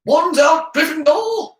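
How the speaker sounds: high, loud battle cry; rallying shout. A narrator's reading. Gryffindor joining the standoff, shouting. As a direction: shout them to arms. House rallying call to arms.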